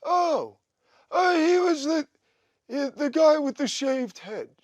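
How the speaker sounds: high, loud mock [name], slowing down; hammed-up [name] finally remembering; imitating Oz. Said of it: "And Oz goes".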